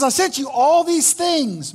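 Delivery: loud, high, stretched "all these things"; emphatic insistence. Acted. Jesus reminding the drowned man, emphatic on "all these things". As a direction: lean hard and slow on "all these things".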